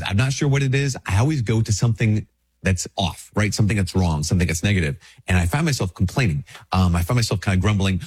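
ordinary talk, nothing marked

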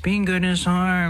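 high whining complaint; whiny voice. Retold or imitated. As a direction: whine it like a sulky child. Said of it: "when we hear that whiny voice".

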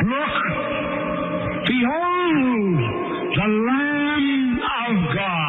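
high, long-drawn proclaiming cry; John the Baptist. Acted. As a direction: call it out over a crowd, stretching the words. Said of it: "And he shouted out".

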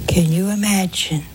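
ordinary talk

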